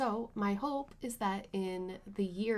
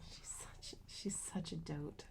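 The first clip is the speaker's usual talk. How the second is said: whispering; 4 semitones lower, 10 dB quieter, slower than usual. Real, not acted. Cooing at the cat.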